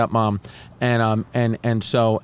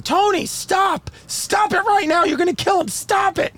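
high-pitched, frantic screamed plea; mother screaming. Retold or imitated. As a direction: scream like a mother trying to stop a fight. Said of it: "I hear my mother screaming".

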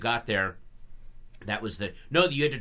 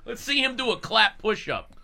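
mocking bully; 11 semitones higher, 5 dB louder than usual. Continuing the bully voice.

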